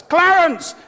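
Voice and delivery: high yell of the name; imitated preacher's yell. Minister calls to the corpse in the casket, yelling. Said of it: "He yells".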